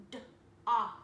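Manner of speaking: ordinary delivery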